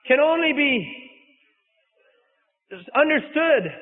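preacher lifting his voice to open the reading; preaching. Acted. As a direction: proclaim it to the congregation. Preacher opens the sermon.

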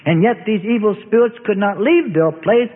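plain reading voice

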